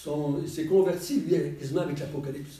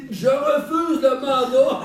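slow, laughing high refusal; 8 semitones higher, 8 dB louder, slower than usual. Retold or imitated. Telling the man he refuses the role, laughing. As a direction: laugh out the refusal in mock protest.

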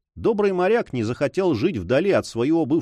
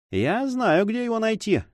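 sly smile; 9 semitones higher than usual. A narrator's reading. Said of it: "с той же хитрой улыбкой".

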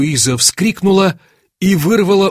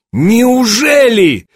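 drawn-out, loud, high exclamation; crying out; 8 semitones higher, 5 dB louder, much slower than usual. A narrator's reading. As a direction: cry out in disbelief, stretching the word. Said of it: "вскричал я". (потрясённый возглас)

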